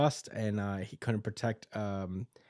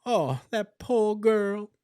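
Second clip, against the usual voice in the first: sweetly pitying nun; 10 semitones higher, 4 dB louder than usual. Retold or imitated. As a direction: coo with false mercy. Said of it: "the Sister Lily Paladin is like".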